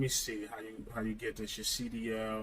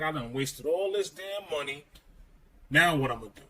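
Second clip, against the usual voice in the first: retold frustration; 4 semitones higher, 5 dB louder than usual. Retelling his panicked regret.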